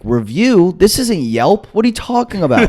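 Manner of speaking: high-pitched, rising disbelief; incredulous protest. Retold or imitated. Arrested student answers cop's review offer, incredulous. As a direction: question it in high, exasperated bafflement.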